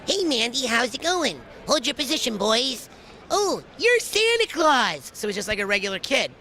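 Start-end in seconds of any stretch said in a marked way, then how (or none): 0.1-5.1 put-on higher, goofy kid voice; Billy cartoon voice. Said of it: "Billy's like".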